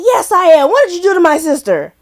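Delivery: high, loud mock challenge; acted angry confrontation. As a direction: confront him, hammed up for the story. Re-enacts confronting the dude.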